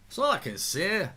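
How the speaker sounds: put-on same line redone in a Scottish accent. Streamer mimicking a Scottish pundit, Scottish accent. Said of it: "that's a good Scottish accent".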